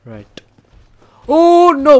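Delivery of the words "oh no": high yelp of alarm, much louder; shrieked oh no, much higher and louder; alarmed shout. Real, not acted. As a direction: cry out as the boss jumps you. Sudden attack in game.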